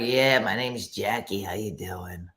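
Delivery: smoky Jackie introducing herself; longtime smoker. Acted. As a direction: play a woman who has smoked for years. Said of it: "You sound like you smoke for years".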